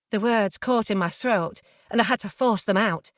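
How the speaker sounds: usual speaking voice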